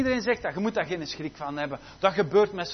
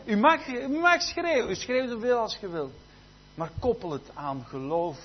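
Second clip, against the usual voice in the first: normal talking voice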